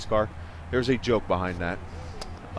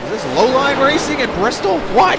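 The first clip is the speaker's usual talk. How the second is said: high, loud, amazed question; surprised; 10 dB louder than usual. Real, not acted. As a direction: blurt it out in disbelief. Sudden surprise at the race.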